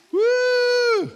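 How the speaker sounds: piercing, sustained playful whoop; high loud whoop. Pretend. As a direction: let out a long, high "woo!". Cries out during overflowing demonstration.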